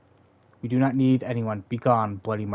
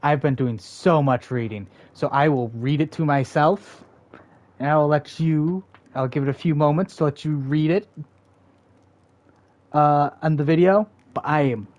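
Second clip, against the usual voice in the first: excited; 4 semitones higher, 7 dB louder than usual. Real, not acted. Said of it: "excited chatter to viewers".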